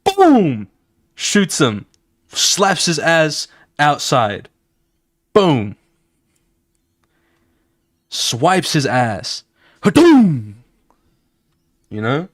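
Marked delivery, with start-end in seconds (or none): 0.0-0.7 shouted high boom sound effect; loud boom. Acted. Narrating the fight to viewers, high loud boom effect. 9.8-10.6 high, loud blast sound effect; explosive hadoom. Acted. Describing the smash into boxes, loud high blast noise. Narrating a big hit.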